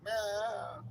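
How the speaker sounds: high, whiny cat meow; high mock meow. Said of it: "Romeo, he's like".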